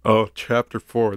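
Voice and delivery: ordinary talk, nothing marked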